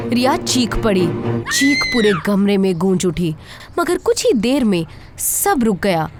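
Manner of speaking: ordinary delivery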